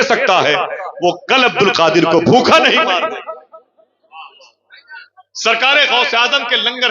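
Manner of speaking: impassioned preacher building to the punchline; fiery preaching. Acted. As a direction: preach it to the crowd. Preaching the story at full force.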